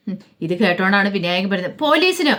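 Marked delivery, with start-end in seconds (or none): 1.8-2.3 alarmed retort. Retold or imitated. Vinayakan alarmed at police idea.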